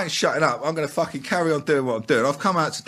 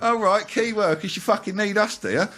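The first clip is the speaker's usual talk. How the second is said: sarcastic mock; 8 semitones higher than usual. Bin man mocking the council calling them key workers, sarcastic. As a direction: drag it out like a smug sneer.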